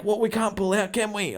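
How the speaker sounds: high-pitched imitation of put-out buyers; protesting buyer. Buyers answering agent about pulling out, protesting. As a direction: mimic a complaining client, voice up high.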